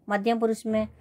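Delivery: ordinary delivery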